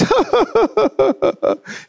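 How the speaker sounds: high choked laugh-cry; teary laugh-sob. Retold or imitated. Father breaking down at the gift, high laughing sob. Dad crying at truck.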